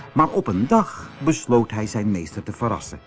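plain reading voice

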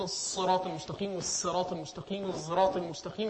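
الصراط المستقيم recited three ways, each reading with its own sounds, softly; three recitation readings. Shown as a demonstration. Teacher softly demonstrating the three readings of الصراط المستقيم. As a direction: give الصراط المستقيم once per reading, changing how the letters are said. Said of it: "احنا بنقول".

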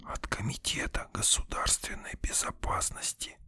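hushed, sinister stage whisper. A narrator's reading. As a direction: whisper it menacingly, after a theatrical pause. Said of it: "зловещим шёпотом произнёс я".

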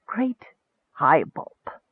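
soft, wondering murmur; whispering. A narrator's reading. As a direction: try out the title softly to yourself. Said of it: "might have whispered".

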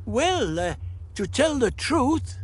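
high, hesitant lilt; evasive old Doctor. Acted. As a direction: stall with eccentric innocence. Doctor answers the Monoid.

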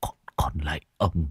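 faint whisper building to a high wail; dying man's plea, rising in terror; feeble pleading. A narrator's reading. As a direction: start barely audible, end in drawn-out begging. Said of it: "thều thào".

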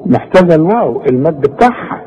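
ordinary delivery

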